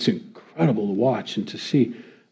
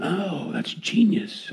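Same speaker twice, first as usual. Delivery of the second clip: slow, high, softly amazed; hushed, higher-pitched admiration as the idea lands; impressed realization; 6 semitones higher, 6 dB quieter, much slower than usual. Retold or imitated. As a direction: let a drawn-out "oh" show you're quietly impressed. Acting out his impressed reaction.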